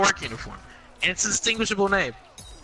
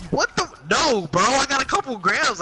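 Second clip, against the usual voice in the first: incredulous; 9 semitones higher than usual. Retold or imitated. Cop asks about pounds.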